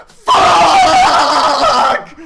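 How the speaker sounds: a huge, high laughing shout; mock panicked yell while fleeing the dinosaur. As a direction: scream the swear as if running for your life. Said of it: "not yelling".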